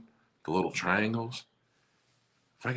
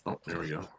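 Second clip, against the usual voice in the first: ordinary delivery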